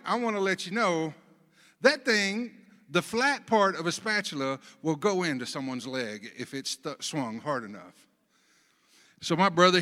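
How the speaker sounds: ordinary delivery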